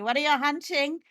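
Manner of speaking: usual speaking voice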